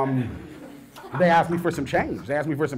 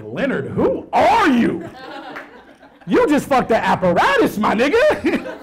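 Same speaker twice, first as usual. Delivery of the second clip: laughing self-scolding; 7 semitones higher, slower than usual. Retold or imitated. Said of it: "looking in the mirror like".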